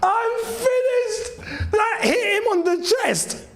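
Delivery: high, shrieking laughing disbelief; a fan in hysterics over a lucky goal; hysterical laughter. Real, not acted. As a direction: laugh until you can barely speak.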